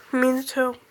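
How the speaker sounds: ordinary delivery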